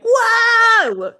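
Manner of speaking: high, loud shrieking "Whoa!"; panicked high scream at a horse; panicked shout. Shown as a demonstration. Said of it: "hear the change in people's voice".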